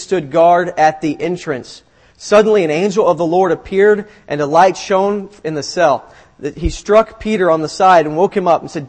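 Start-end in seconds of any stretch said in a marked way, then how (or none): none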